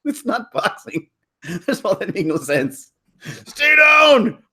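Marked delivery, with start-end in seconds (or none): 3.4-4.4 very high, loud crying mock; mock crying yell. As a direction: ham up a wailing girl. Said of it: "he said".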